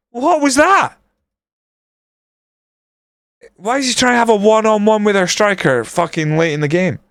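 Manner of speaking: high-pitched incredulous outrage; real exasperated rant. Real, not acted. Gamer after Ferdinand passed to their striker, high exasperated rant.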